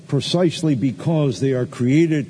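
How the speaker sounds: ordinary talk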